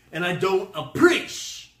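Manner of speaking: hammed-up offended complaint; mock indignation. Mock complaint, first take.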